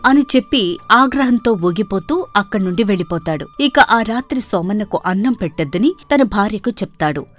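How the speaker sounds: usual speaking voice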